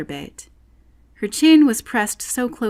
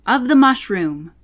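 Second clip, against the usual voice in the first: curt answer; 5 semitones higher, 7 dB louder than usual. A narrator's reading. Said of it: "said the caterpillar".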